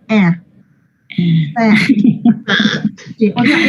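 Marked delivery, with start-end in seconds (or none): 0.1-1.8 "Ah" as a twisted A-to-E sound, like English "fat"; "Ah" as the short English æ, mobster-mouthed; mafioso vowel. Shown as a demonstration. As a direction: say "Ah" with a wide A mouth pulled sideways into E. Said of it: "se tuerce para un lado y quedo con cara de mafioso".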